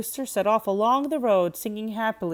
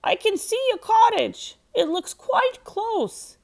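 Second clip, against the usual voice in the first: high, louder calling rooster, slowing down; 13 semitones higher, 4 dB louder, slower than usual. A narrator's reading. Rooster calls to his sleepy friends, high and loud. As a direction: call out to the friends from afar. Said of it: "he called".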